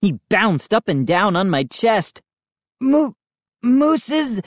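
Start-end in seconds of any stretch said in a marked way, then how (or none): 2.8-4.4 high, strained, broken words; wheezing. A narrator's reading. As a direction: struggle for air as you speak. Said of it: "I wheezed".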